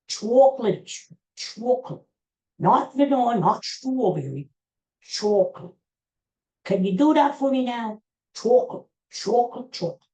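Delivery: a light Bernie Sanders voice, ordering ice cream; lightly voiced Bernie at the counter; Bernie Sanders impression. Ordering chocolate at Dairy Queen as Bernie, lightly voiced. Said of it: "I'm gonna do my Bernie Sanders impersonation".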